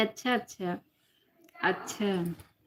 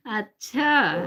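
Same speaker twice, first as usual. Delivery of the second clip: word said through a giggle; amused, laughing; 3 semitones lower, much slower than usual. Real, not acted. Streamer reacting to viewer's reason for laughing, amused laughing. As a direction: let the laugh carry the word. Answers in between her laughs.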